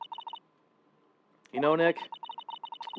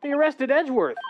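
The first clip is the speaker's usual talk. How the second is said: urgent; 9 semitones higher, 5 dB louder than usual. Acted. Urgent news to friend.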